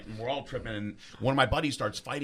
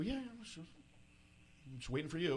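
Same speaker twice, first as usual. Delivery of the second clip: very soft, higher, tentative reply; sheepish quiet; 6 semitones higher, 12 dB quieter, slower than usual. Retold or imitated. Tripping student answering the cop, sheepishly quiet. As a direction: answer small and careful, slowing at the end.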